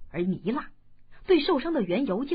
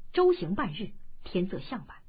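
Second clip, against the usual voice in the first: plain reading voice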